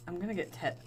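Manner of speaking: ordinary talk, nothing marked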